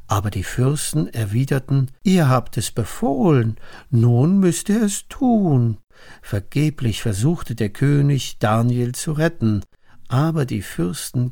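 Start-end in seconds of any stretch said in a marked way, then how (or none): 2.0-5.8 higher, emphatic demand; insistent. Acted. Princes force the king to obey his own order, insistent. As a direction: hold the king to his word.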